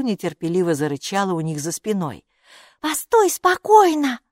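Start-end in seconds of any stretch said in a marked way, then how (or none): 2.8-4.2 high, slow, loud plea; high-pitched insistent little creature; little character pleading. A narrator's reading. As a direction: beg in a small high voice. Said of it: "попросила Майки".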